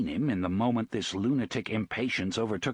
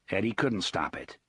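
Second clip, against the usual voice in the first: plain reading voice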